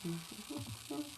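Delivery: whispered, quick private aside; murmured note to self while live. Real, not acted. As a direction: whisper it off-mic to yourself. Aside off the stream.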